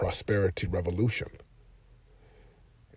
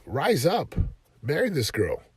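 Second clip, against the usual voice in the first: higher, slow, forceful push to rise up; rallying call to a client; 8 semitones higher, slower than usual. Retold or imitated. Coach urging a client on a call, forceful and high. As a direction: fire the client up to act. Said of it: "we finally just said".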